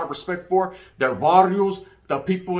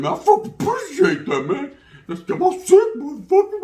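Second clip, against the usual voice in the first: excited laughing thanks; 13 semitones higher, 5 dB louder than usual. Retold or imitated. Thanking the guy after the fight.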